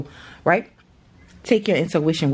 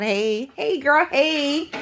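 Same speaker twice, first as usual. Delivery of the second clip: put-on light, higher sing-song girl's hey; imitating daughter; 7 semitones higher, much slower than usual. Mom imitating her daughter greeting, higher and playful. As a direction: bounce it like a little girl. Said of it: "My little girl in the kitchen talking about".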